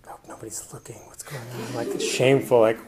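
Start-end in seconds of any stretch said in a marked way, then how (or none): 0.1-1.7 hushed secret whisper; inner whisper. Retold or imitated. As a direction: whisper as if afraid someone sees. Inner thought, afraid of being seen.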